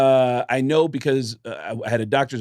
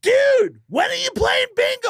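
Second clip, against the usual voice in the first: loud, very high shout; hammed-up high-pitched excitement; 5 dB louder than usual. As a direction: imitate a rocker screeching with eagerness. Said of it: "And he goes".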